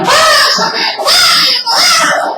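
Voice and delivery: high, shrill-pitched mock yell at the kids; parent yelling. Parent yelling at children to pass something, imitated high yell. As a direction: bark it like a fed-up parent. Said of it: "les grita".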